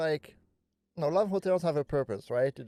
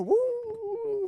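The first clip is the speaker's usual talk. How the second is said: much higher gleeful whoop; excited woo. Pretend. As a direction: whoop like sliding down. Miming going down a slide.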